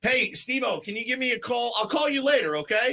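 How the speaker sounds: high, lightly yelled Philly voice; Philly accent. Retold or imitated. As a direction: imitate the buddy from Philly calling. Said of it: "he sounds just like that".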